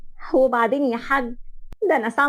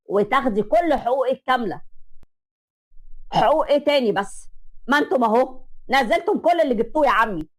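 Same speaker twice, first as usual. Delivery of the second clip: ordinary talk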